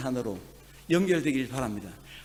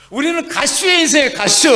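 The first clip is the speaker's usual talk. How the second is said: laughing through the words, a bit louder; amused preacher laughing out the punchline; 10 semitones higher, 10 dB louder than usual. Real, not acted. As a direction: chuckle at your own joke while saying it. Laughs at own dialect joke.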